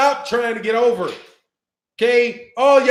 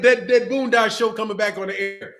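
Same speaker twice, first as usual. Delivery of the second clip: normal talking voice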